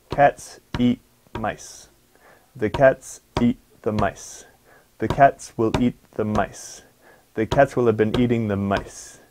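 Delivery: each sentence on the same three beats. Shown as a demonstration. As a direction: squeeze the little words in, keep the beat. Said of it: "It's always gonna have the same beat".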